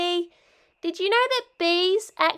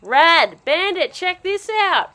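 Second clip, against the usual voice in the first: normal talking voice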